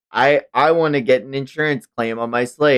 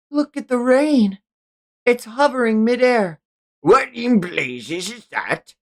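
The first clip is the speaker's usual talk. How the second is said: astonished sailor; 12 semitones higher than usual. Acted. Crew seeing hovering rain.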